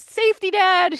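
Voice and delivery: high, loud scolding; scolding daughter. Retold or imitated. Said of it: "I was".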